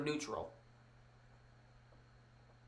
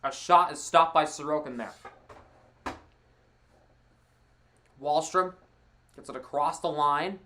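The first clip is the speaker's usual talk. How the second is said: play-by-play. Acted. Live hockey play-by-play.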